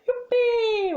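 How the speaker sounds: hammed-up high cheering youpi; mock cheer. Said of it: "Est-ce qu'on fait semblant".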